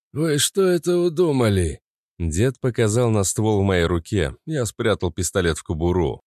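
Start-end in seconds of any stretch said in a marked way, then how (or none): 0.1-1.8 put-on old man voice. Old man voice, far timbre.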